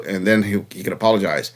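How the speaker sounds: ordinary talk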